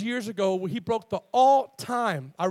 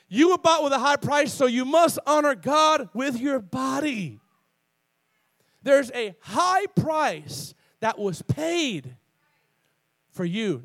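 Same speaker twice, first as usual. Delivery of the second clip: loud, high, drawn-out sermon delivery; full-force preaching; 4 semitones higher, 4 dB louder, slower than usual. Real, not acted. Preacher telling the congregation they were bought, full-force preaching. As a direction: preach it loud, stretching the big words.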